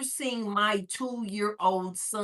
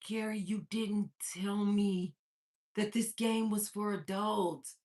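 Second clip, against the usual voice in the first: slow, softer retold reproach; subdued scolding; 7 dB quieter, slower than usual. Mother to son after logging out, subdued scolding. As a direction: spell it out slowly and quietly.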